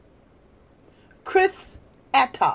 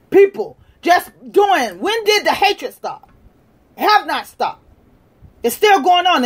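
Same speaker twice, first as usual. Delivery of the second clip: ordinary talk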